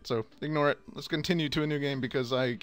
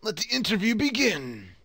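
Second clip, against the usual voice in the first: high, loud heroic announcement; 8 semitones higher, 5 dB louder than usual. Acted. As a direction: proclaim it like a grand hero. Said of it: "go ahead with the hero voice".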